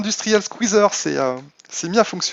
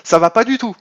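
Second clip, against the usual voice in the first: raised, louder half-joking telling-off; mock angry scolding; 4 semitones higher, 7 dB louder than usual. Streamer scolds his absent secretary, mock angry. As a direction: scold a lazy employee in jest, voice up.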